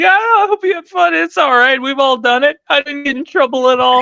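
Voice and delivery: imitating the boss. Salesman imitating his general sales manager, high mock voice. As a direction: play the boss as a squeaky, amused pushover. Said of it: "He's like".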